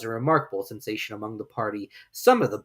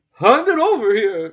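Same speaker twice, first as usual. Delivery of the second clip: much higher, louder, slow commanding bird; dodo voice; 10 semitones higher, 11 dB louder, slower than usual. Acted. Dodo ordering Alice, loud and slow. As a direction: demand it slowly.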